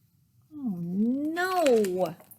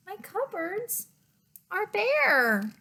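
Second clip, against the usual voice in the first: high plaintive bear; 10 semitones higher than usual. Acted. Bear laments his empty cupboard.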